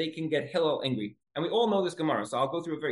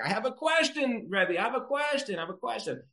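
imitating repeated pestering; 8 semitones higher than usual. Man coming back to bother the Rabbi, high singsong. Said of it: "every time he comes back".

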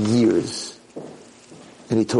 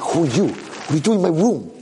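higher, alarmed questioning; startled demand; 5 semitones higher than usual. Acted. Kid finding old man in his room, startled demand. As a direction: challenge the intruder in surprise.

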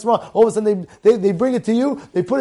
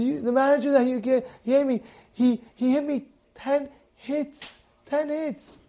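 soft, halting, hurt worker; imitated tearful complaint; 8 dB quieter than usual. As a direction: report the beating in a small, pained voice.

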